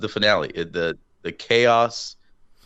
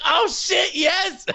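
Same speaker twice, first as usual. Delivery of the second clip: excited; much slower than usual. Retold or imitated. Fan getting a Batman toy, thrilled yell. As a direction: squeal with joy over the gift. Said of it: "I'd be like".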